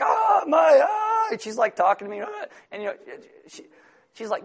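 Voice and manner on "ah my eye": high, loud, very slow mock cry of pain; hammed-up pained yell. Pastor acting his overreaction in bed, mock pained yell. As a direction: howl over the eye like it's a disaster. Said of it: "I'm like".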